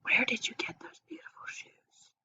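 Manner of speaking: soft unvoiced whisper of a curious girl; Anna asks a friend in a whisper about her shoes. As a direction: whisper it like a secret compliment. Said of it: "Anna whispered".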